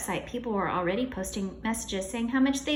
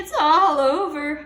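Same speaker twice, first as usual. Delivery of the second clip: groaned; 6 semitones higher, 10 dB louder, slower than usual. Acted. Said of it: "groaned Mister Cooper".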